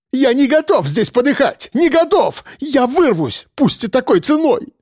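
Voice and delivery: high-pitched, desperate outburst; losing control. A narrator's reading. Cornered policeman refuses to die here, hysterical. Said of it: "сорвался он, лицо перекосилось".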